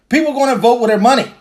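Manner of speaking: punchy rallying call; emphatic. Real, not acted. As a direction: drive the point home hard. Emphatic declaration to camera.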